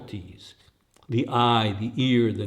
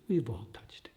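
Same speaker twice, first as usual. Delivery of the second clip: soft unvoiced whisper, let it fall away; an intimate whispered echo of the line; 10 dB quieter than usual. Shown as a demonstration. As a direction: breathe the words as a hushed echo.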